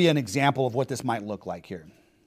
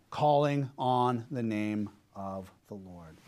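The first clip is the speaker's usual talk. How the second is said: soft, deliberate, lower; hushed, weighty answer; low, slow answer; 9 semitones lower, 10 dB quieter, slower than usual. Real, not acted.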